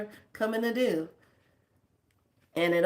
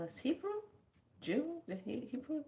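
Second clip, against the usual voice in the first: much higher, softer puzzled question; incredulous; 9 semitones higher, 9 dB quieter than usual. Retold or imitated. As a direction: ask in disbelief. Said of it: "And I go".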